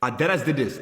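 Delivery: ordinary talk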